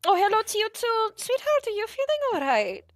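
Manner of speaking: very high, sugary motherly voice; doting mother. Acted. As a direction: coo over them like a fussing mom.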